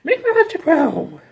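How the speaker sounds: high, hammed-up villain order; cartoon villainess barking an order; Rita's command. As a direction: shriek it like a campy witch queen. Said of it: "Rita makes Pineapples the Clown grow giant".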